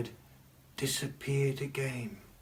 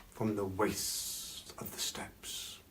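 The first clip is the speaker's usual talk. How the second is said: ominous whisper; 3 semitones lower, 8 dB quieter, slower than usual. Acted. Ominous story of the dogmen.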